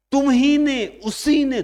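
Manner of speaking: high and loud, stress on ही; emphatic. Shown as a demonstration. Teacher shows emphasis on ही to the class, high and loud. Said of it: "जोर दिए".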